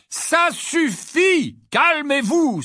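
loud, high shout over noise; drawn-out shouted command; exclaimed order. A narrator's reading. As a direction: call the noisy children to order. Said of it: "s'exclama monsieur Wesley".

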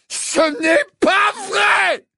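slow, throat-tearing high scream. A narrator's reading. Harry denying Dumbledore's words, screaming. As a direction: howl it with all your force. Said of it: "hurla Harry si fort que sa gorge semblait".

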